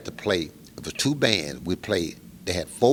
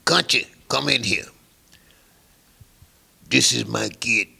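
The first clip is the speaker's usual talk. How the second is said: loud, higher, commanding bark; gruff boss call; 4 semitones higher, 5 dB louder than usual. Retold or imitated. Mob boss to young musician, loud gruff command. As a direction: summon the kid like you own the room.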